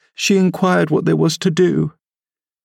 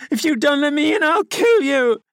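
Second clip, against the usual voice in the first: shrieking, much higher, falling end; berserk rage; 13 semitones higher than usual. A narrator's reading. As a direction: scream the threat again, wilder. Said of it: "he rather shrieked than said".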